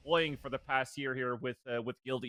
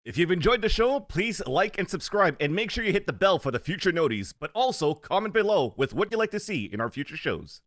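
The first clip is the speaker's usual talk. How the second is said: louder upbeat channel plug; announcer outro; 3 semitones higher, 9 dB louder than usual. Acted. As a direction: sell the subscribe call like a host. Outro ad read.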